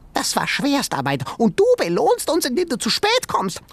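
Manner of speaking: very high, reproachful animal voice; tiny creature voice. A narrator's reading. Sengi scolding Ben for arriving late, very high creature voice. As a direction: scold him like an offended critter.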